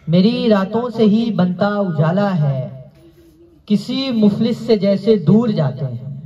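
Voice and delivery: measured, projected mushaira verse; declaimed Urdu couplets; poetry recitation. Acted.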